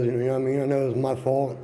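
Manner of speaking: normal talking voice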